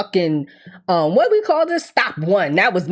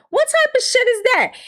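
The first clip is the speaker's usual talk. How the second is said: high, baffled outburst; can't believe what she heard; squeaky disbelief; incredulous. Real, not acted.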